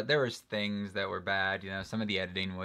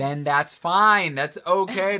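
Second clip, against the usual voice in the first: exasperated; 9 semitones higher, 11 dB louder than usual. Real, not acted. Exasperated argument with cohost.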